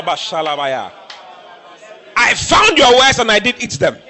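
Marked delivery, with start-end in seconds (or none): 2.1-4.0 loud, high shout of the verse; shouted declaration. Acted. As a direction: proclaim it at full voice.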